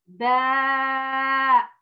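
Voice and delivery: very drawn-out باء, long aa; long vowel. Shown as a demonstration. Teacher shows the long vowel to Ola, drawn out. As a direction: stretch باء to two beats. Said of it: "هنا أطلت الصوت".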